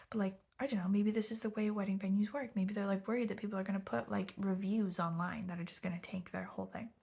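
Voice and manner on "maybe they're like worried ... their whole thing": ordinary delivery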